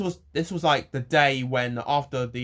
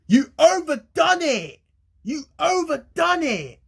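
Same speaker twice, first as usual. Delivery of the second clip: high, loud shrieking teacher; teacher yelling; 7 dB louder, slower than usual. Retold or imitated. Retelling how the cookery teacher yelled at him, high and loud. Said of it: "That's what she went. Like that".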